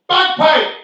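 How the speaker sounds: loud, high hammed-up Bajan accent; best Barbados. Actor asked for his best Barbados, shouting it in Bajan accent. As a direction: belt it out in a big Bajan accent. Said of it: "do it as you said in your best Barbados".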